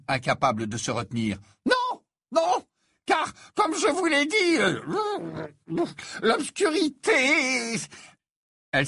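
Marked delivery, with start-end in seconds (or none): 1.7-7.8 very high, slow, stammering seer; a dithering prophetess confessing reluctantly; flustered seer. Acted. Trelawney insists, distressed.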